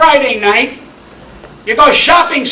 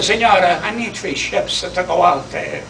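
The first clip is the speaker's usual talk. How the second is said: soft, humble plea to the queen; hushed, lightly voiced asking; quiet plea; 3 semitones lower, 6 dB quieter than usual. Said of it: "and he said".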